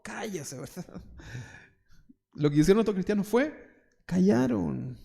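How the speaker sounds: ordinary delivery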